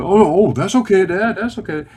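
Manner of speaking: high, loud, over-eager forgiveness; hasty reassurance. Retold or imitated. Son answering dad's apology, eagerly reassuring. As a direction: imitate a son rushing to let dad off.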